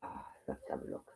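very low, whispered aside; barely audible, absent-minded murmur; muttering to self. Real, not acted. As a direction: whisper it to yourself while searching. Muttering to self while searching.

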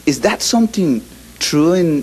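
ordinary talk

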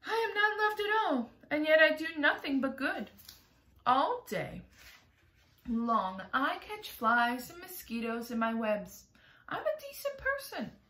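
high, hurt complaint; wronged spider. Acted. Miss Spider laments to James, high and hurt. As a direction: plead your innocence, high and hurt.

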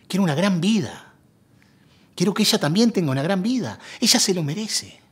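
loud, high, desperate plea; impassioned outburst. Acted. Unhappy husband confessing to his old love, impassioned outburst. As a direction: burst out with pent-up longing.

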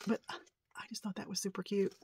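soft whispered aside. Real, not acted. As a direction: whisper it to yourself. Admiring voodoo doll.

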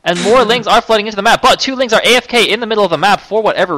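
rising, loud live commentary; excited play-by-play. Real, not acted. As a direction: call the action as it happens.

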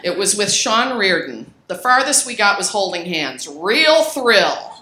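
loud, giggly diary reading that sends up her younger self; reading old journal aloud. Acted. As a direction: read your thirteen-year-old self with amused relish. Reads her teenage journal aloud.

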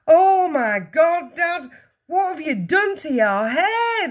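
slow, high, loud horrified shout. A narrator's reading. Son sees Dad's hair, shouting. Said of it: "the son shouted".

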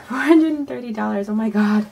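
giddy at the big total; laughing delight. Real, not acted.

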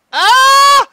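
high, loud startled yell; startled scream. Real, not acted. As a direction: jump at a sudden scare. Sudden scare.

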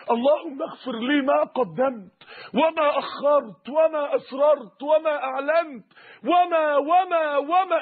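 slow, drawn-out plea, climbing in pitch; supplication. Retold or imitated. The Prophet asks his Lord for forgiveness, slow imploring supplication. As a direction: plead at a measured pace, building as the list grows.

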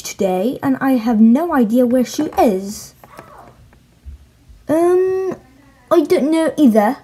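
awful put-on British accent. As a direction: play Harry and Ron in a clumsy British accent. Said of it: "sorry for the awful British accent".

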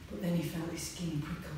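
usual speaking voice

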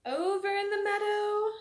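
ordinary talk, nothing marked

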